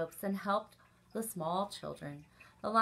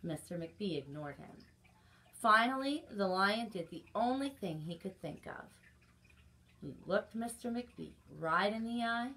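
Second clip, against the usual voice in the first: ordinary delivery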